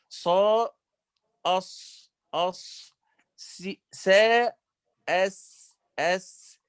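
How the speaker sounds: أص and أس with a hissing sibilant; letter demo. Shown as a demonstration. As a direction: let the air hiss on أص and أس. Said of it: "هذا الصوت ضعف في المخرج". (نطق الصاد والسين الساكنتين بالهمس)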